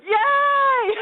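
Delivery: very high, loud squeal; mock-jubilant yay breaking into laughter; hammed-up cheer. Actor offered townsperson one, hammed-up squealed cheer.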